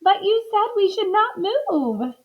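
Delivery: high, plaintive protest; tearful. A narrator's reading. Missus Watson answering her husband, high and tearful. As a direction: wail it lightly, near tears. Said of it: "Missus Watson started to cry".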